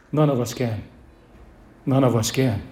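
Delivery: hushed. Real, not acted. Preacher answering his own question in a hushed voice. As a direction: drop to a low, soft answer.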